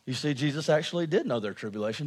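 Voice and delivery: usual speaking voice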